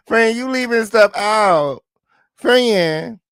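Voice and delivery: high, loud, drawn-out reproach; exasperated calling-out. Real, not acted. Host tells friend she's leaving stuff out, exasperated. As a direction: call it out high and loud, stretching the words.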